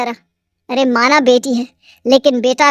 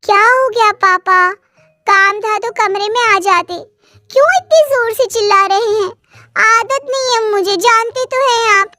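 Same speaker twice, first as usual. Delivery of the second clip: put-on higher, irritated young girl; spoilt daughter; 7 semitones higher than usual. A narrator's reading. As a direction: whine back at the father, annoyed. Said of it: "वो जोर से मिन्नी को आवाज देते हैं". (चिढ़ी हुई बेटी की ऊँची आवाज़)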